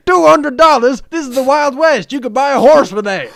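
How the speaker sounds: very high, loud outraged cowboy; shocked cowboy. Pretend. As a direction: yelp it like a shocked prospector. Wild West shocked reply.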